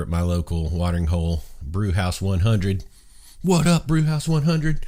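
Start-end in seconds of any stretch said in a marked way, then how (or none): 3.4-4.8 high-pitched, hyped greeting; shout-out. Real, not acted. Host shouts out his local watering hole, hyped greeting. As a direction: call out to your favorite hangout.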